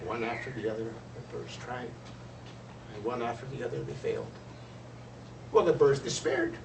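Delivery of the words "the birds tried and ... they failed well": ordinary delivery, nothing marked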